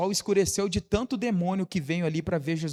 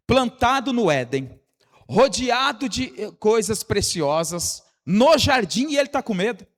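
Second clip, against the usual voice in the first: loud, higher-pitched sermon delivery; insistent, raised preacher's voice; full-force preaching; 7 semitones higher, 6 dB louder than usual. Real, not acted. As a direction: drive each point home to the congregation.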